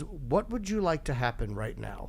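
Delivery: a quiet private question, slow. Retold or imitated. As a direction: say it hushed and measured. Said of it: "he quietly goes".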